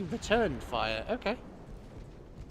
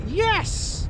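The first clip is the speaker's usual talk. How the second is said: excited cheer; 5 dB louder than usual. Real, not acted. Cheering his ship's victory.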